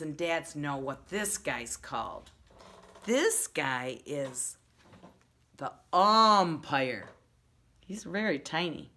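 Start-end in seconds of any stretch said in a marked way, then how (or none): 3.0-7.1 slow, louder "the umpire"; slow reveal. Shown as a demonstration. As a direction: build a little suspense. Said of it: "slow reveal".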